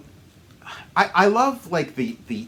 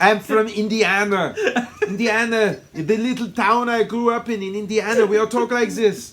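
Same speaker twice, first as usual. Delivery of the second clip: higher, louder mock Austrian accent; Schwarzenegger; 6 semitones higher, 5 dB louder than usual. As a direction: play it big with a comic Austrian accent. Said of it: "he talks the way he does".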